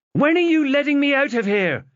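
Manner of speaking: high, loud demanding cry; teen girl shouting to be let out. Acted. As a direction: yell it at the door, desperate to leave. Chloe charges at the door.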